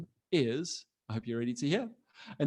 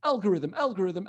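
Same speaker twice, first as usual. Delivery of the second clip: "algorithm" rattled off twice, higher; really fast; 4 semitones higher than usual. Shown as a demonstration. Teacher leads the class in a tongue twister, "algorithm" fast. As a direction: race through "algorithm" without a gap. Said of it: "say it three times really fast".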